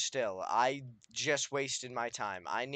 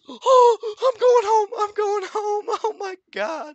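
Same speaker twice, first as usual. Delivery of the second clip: very high, loud screaming panic; terrified scream; 11 dB louder, slower than usual. Real, not acted. Player fleeing a ghast, shrieking in terror.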